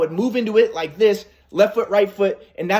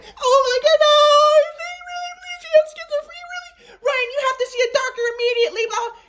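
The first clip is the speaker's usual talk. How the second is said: much higher, fast, frantic mother voice; hysterical mom. Retold or imitated. Mother telling her son to see a doctor, high and frantic. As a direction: squeal it like a panicked mother. Mom mocks son's belief.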